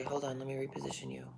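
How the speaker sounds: fast hushed whisper. Real, not acted. Whispering while repositioning the camera. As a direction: mutter it under your breath quickly.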